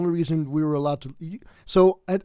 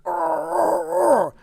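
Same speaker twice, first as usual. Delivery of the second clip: high, loud mock growl like he wants to fight; aggressive Bronx growl; 12 semitones higher, 4 dB louder, much slower than usual. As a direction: growl it out like a tough guy from the Bronx. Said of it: "because of the fact that I'm just like".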